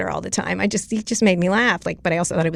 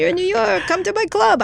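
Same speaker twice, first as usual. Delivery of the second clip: much higher, breezy imitated Scaramucci invite; Scaramucci invitation; 12 semitones higher than usual. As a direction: mimic him inviting her over, bright and pushy.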